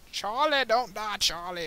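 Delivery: high, loud begging; desperate plea. Real, not acted. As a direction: beg the crewman not to die. Pleading to dying crewmate.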